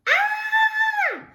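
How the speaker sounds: piercing very high scream; shriek. A narrator's reading. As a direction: shriek in terror falling. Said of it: "shrieked Flap".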